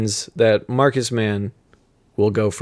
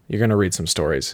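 ordinary talk

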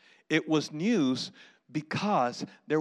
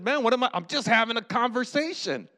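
higher, mildly indignant protest; defensive protest; 4 semitones higher than usual. Acted. David answers his frustrated brother, defensive protest. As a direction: protest innocence to a scolding older brother.

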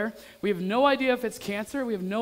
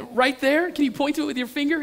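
higher, louder, hurried questioning; mock-panicked rapid questions; freaked-out dad; 6 semitones higher, 4 dB louder than usual. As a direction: re-enact an anxious dad pressing for specifics. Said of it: "I'm freaking out".

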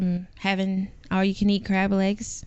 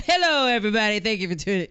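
imitating his intro; 3 semitones higher, much faster than usual. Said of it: "Don't try to come in like him".

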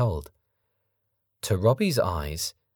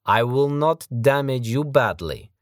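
menacing foreign thug; 6 semitones higher, 5 dB louder than usual. Acted. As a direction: sneer it like a big brute. Sergei's threat.

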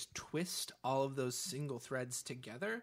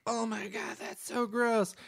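disgusted; 9 semitones higher, 5 dB louder, slower than usual. Retold or imitated. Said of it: "some of them were like".

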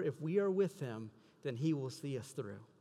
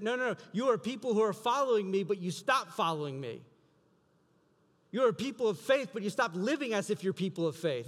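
louder, higher pleading; earnest raised preaching voice; voicing Jesus; 5 semitones higher, 5 dB louder than usual. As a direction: press each line out with force. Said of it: "What he's saying is that".

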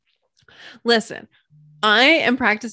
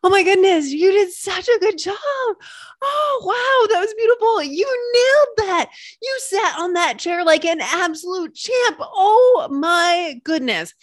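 shower someone with over-the-top praise; like a delighted cheerleader praising a friend; gushing praise; 7 semitones higher than usual. Pretend. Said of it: "somebody was constantly telling you".

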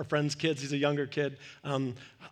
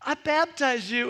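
higher, slow mock-ceremonial child voice; mock solemn kid; 9 semitones higher, 5 dB louder than usual. Kid pretending to baptize a friend, slow mock solemnity. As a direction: play a little preacher at the hot tub. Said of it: "one of the kids was like".

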